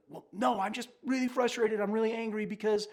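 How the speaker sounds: imitating the wife, high and exasperated; frustrated wife. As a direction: voice her hurt and frustration. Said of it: "the answer would be like".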